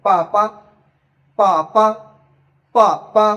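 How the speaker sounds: বাবা said as পা পা; punchy পা পা with a P sound; Chinese pronunciation. Shown as a demonstration. Teacher modelling Chinese for learners, crisp পা পা with P. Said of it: "এটাকে চাইনিজে কি বলে".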